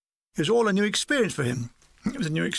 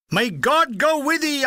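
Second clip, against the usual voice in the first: high, slow theatrical blessing; mock dramatic; 8 semitones higher, 4 dB louder, slower than usual. A narrator's reading. Gunner sending comrades off, hand on heart, mock dramatic. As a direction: ham it up as a grand farewell. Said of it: "striking a dramatic pose".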